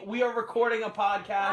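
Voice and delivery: shouting toward another room; calling out. Acted. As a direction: call out to someone elsewhere in the house. Calling out to someone in house.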